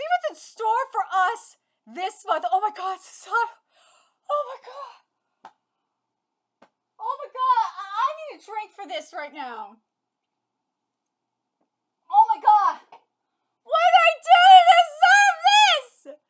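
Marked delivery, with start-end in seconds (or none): 2.5-8.7 overwhelmed. Real, not acted. Overwhelmed by a manga panel. 12.1-16.2 screamed high and loud; real fan scream; ecstatic. Real, not acted.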